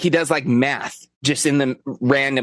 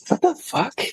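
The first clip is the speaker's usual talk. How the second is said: shocked; 14 semitones higher than usual. Retold or imitated. Shocked reaction quoted in story.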